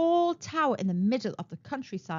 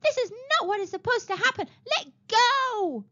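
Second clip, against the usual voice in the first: much higher protesting cry; indignant shriek; 11 semitones higher than usual. A narrator's reading. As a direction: protest while being carried off. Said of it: "shrieked Princess Reverie".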